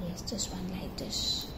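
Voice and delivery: soft unvoiced whisper. Real, not acted. Mother whispering to her toddler during the story.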